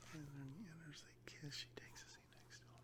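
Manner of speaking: soft whispered read-through; whispering. Real, not acted. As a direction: murmur the script to yourself. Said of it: "read, um, sort of under my breath".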